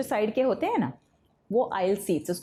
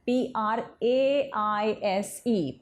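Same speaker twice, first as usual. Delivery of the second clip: slow spelling; much slower than usual. Shown as a demonstration. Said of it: "teacher spells word slowly".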